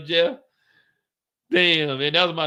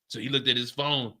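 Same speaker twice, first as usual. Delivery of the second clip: ordinary talk, nothing marked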